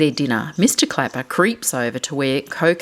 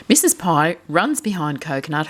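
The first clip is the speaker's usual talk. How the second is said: usual speaking voice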